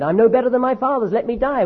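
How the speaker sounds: high, wailing plea to die; lamenting prophet begging God to let him die; despairing prophet. Retold or imitated. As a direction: cry out in hammed-up despair. Elijah's despair voiced high.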